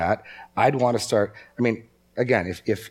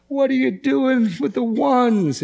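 high, slow horrified cry; horrified friends; 5 dB louder, slower than usual. Retold or imitated. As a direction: gasp it out as an appalled friend. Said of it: "look on horrified and say".

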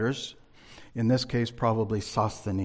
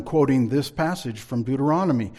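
ordinary talk, nothing marked

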